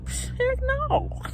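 high, put-on squeak of a flat refusal; mock refusal. Mom retelling refusing to leave the car, mock high voice. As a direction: play her own answer back in a silly high voice. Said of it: "And I'm like".

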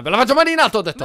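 loud, high hands-up command; mock-police shout; shouted order. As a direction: order them at gunpoint, loudly. Orders player to raise hands.

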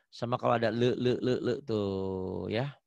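usual speaking voice

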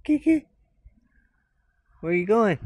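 high, loud call to the cat. Real, not acted. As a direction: call her from across the yard. Calling out to the cat.

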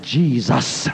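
firm, low, steady name, no stutter; with authority. Retold or imitated. Calling on Jesus against the gunman, firm authority. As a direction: say it like you command it. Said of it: "I said it with authority".

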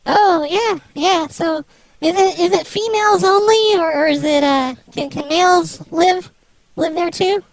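voice-changed woman's voice; fake female voice. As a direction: play a woman over the phone. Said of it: "I can stop with this whole charade thing".

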